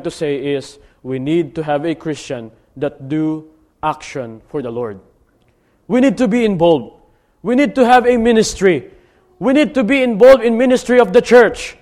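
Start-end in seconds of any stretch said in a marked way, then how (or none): 5.9-11.8 rising, fervent sermon call; preaching at full force. Acted. Preacher rallies his church to get involved, fervent. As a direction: drive the point home to the pews.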